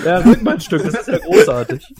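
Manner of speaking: very high, loud laughing delight; excited laughing. Real, not acted. Greeting the arriving friends, excited laughter. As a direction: squeal it with joy.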